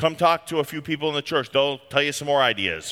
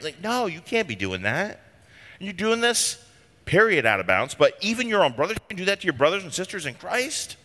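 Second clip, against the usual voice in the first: ordinary talk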